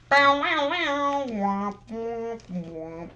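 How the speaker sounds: goofy womp noises. Pretend. Making a goofy sound.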